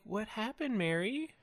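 high-pitched, incredulous question; mock dismay. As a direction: confront a coworker in mock disbelief. Acted confrontation of a turncoat coworker.

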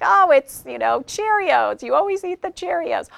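lightly voiced annoyed roommate; complaining roommate. Roommate complaining about the eaten Cheerios, high and whiny. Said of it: "they're like".

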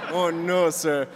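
mock German accent through laughter; theatrical German waiter impression; German Willy Wonka. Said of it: "like, a German Willy Wonka".

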